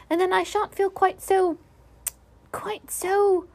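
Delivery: high little voice reasoning, then hesitating; small creature's high-pitched musing; concentrating, to himself. A narrator's reading. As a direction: work it out aloud, rising into unsure pauses. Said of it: "with a frown of concentration".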